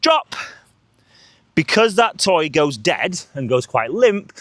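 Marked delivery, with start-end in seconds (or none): 0.0-0.4 sharp high cue word; bright high command to the dog; release cue. Shown as a demonstration. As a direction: snap the cue out high and clear. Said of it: "bring it to your legs like this".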